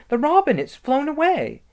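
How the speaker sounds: put-on high, loud cry as a little girl; urgent, high-pitched exclamation; excited cry. A narrator's reading. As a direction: cry out the alarming news. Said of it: "cried Lucy".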